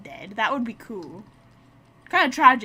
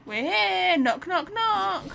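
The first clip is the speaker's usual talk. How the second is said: playful excited knocking; 9 semitones higher, 5 dB louder, much slower than usual. Real, not acted. Arrives at temple, playful knocking.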